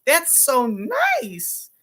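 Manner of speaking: high, very slow mock gush. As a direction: stretch it out high like a fake compliment. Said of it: "When someone's voice goes up".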